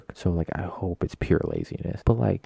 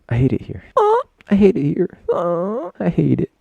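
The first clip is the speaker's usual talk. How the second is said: sudden fit, rising to a high shriek; 12 semitones higher, 5 dB louder than usual. Real, not acted. Let the complaint spiral up into a squealing outburst. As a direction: build from a grumble to a high, loud squeal. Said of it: "I don't know why I do that".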